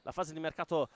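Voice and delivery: fast, loud live play-by-play; breathless italian goal commentary. Acted. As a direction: call the action as it happens.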